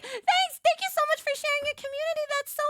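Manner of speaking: bubbly high fifties waitress welcome; diner waitress. Acted. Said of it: "diner waitress VTuber".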